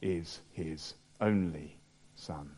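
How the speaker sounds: soft, slow, hushed after the climax. Acted. Preacher after the climax, softly and slowly to the hall. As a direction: let it fall to a grave hush.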